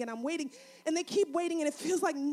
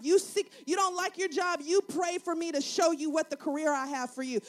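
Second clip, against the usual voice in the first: ordinary delivery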